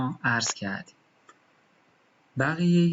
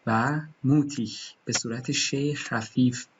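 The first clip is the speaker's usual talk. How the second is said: normal talking voice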